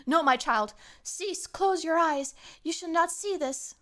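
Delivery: high, hammed-up mother's warning; melodramatic mother. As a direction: shield the child with mock solemnity. Acted mother shielding child from color.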